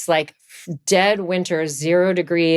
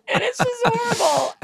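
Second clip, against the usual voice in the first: whiny. Real, not acted. Whining about dreaded ski trips.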